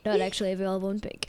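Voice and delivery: low, fast, softened aside; rapid dropped-voice disclaimer; quick disclaimer. Pretend. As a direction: rattle it off like ad small print. Disclaimer after mock ad.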